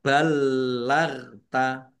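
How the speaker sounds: ballagta very slowly, l drawn out; pressed, held l in ballagta. Shown as a demonstration. Teacher models tasdid, l pressed and held in ballagta. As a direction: hold the doubled l in ballagta. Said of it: "ditekan dan ditahan biar tampak doublenya".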